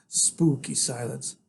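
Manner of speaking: ordinary delivery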